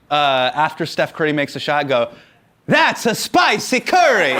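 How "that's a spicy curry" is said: high, hammed-up Italian accent; catchphrase in a mock Italian accent. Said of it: "In an Italian accent".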